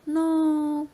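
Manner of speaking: "no" started high and drawn out downward; long, high "no" easing down; falling tone. Shown as a demonstration. Student demonstrating a falling tone, "no" long and descending. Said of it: "ada yang menurun seperti".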